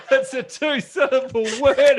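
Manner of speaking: laughing through a teasing correction. Real, not acted. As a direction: giggle out the correction.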